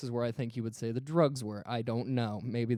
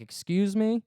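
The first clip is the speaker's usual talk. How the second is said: pitched-up, affronted question in imitation; mock indignant; 8 semitones higher, 4 dB louder, slower than usual. Cop answering a driver's insult, indignant retold challenge. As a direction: act taken aback by the insult, higher.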